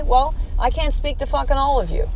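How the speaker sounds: ordinary talk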